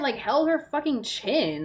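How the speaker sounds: ordinary delivery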